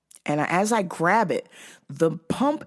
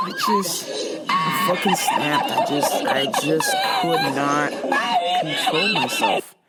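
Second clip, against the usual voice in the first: words squeezed through real laughter; laughing hard. Real, not acted. Storyteller admitting they snapped, laughing hard. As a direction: try to talk while cracking up. Talks over hysterical laughter.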